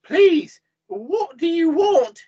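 high, loud plea; imploring, high female voice; would-be croak. A narrator's reading. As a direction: beg in a high, drawn-out voice. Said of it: "you croak".

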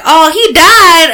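put-on high, slow, drawn-out little girl voice; mimicking little girl. Mimicking a girl at the movie, high and slow. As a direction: stretch it out like a sad little girl. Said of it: "the little girl like".

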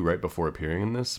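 normal talking voice

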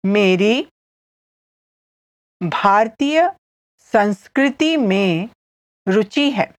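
slow teaching model with long pauses between phrases; said slowly. Shown as a demonstration. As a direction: break it into small pieces for learners. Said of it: "I'll say it again slowly".

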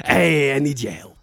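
put-on light Yiddish accent, old deli guy. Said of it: "Not Shelley".

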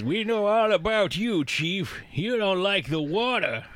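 high, drawn-out movie-character impression; hammed-up film quote, pitched well up; Harry. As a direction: raise the pitch and taunt the chief in character. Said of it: "Harry comes up and says".

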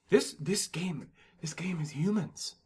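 slow hushed whisper. Pretend. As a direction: breathe it like a secret. Hypothetical answer, hushed.

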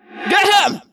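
loud, high, furious command; villain's shouted cry. A narrator's reading. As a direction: shout the order to attack. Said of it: "Visser Three cried".

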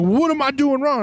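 high-pitched imitation of an offended worker; indignant mock challenge. Worker demands to hear what his mistake is, indignant. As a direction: throw the question back, pitched right up.

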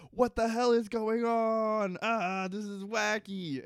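high, drawn-out comic wail; mock freak-out. Desmond reacts to the chaos, high drawn-out mock alarm. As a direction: stretch each word in exaggerated alarm. Desmond bewildered on waking, acted out.

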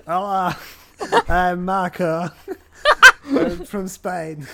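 put-on laughing through a hammy Spanish accent; slow, giggly fake Spanish accent; bad Spanish accent. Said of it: "extremely bad imitation of a Spanish accent".

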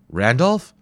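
usual speaking voice

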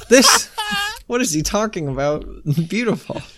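laughing while talking; cracking up mid-sentence. Real, not acted. Laughing at David Blaine video.

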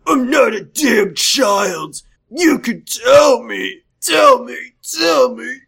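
high, drawn-out mock shout; hammed-up patient hollering for answers; angry demand.

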